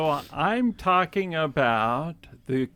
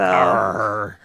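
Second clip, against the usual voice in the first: pirate growl; 7 semitones lower, 6 dB louder than usual. Pretend. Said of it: "Yes. You are".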